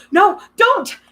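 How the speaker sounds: high, loud desperate scream. A narrator's reading. Girl screaming at Percy not to look, desperate. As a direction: scream to stop him looking up. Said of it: "Annabeth screamed".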